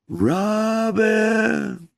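high, drawn-out call from across a pasture; distant call. Retold or imitated. Mysterious voice calling a boy across the pasture, high and drawn out. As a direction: call the name like a far-off voice. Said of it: "I heard this sound".

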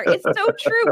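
high, loud burst of agreement; emphatic agreement. Real, not acted. Host agreeing with guest about revival, high emphatic burst. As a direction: pitch it up with delight.

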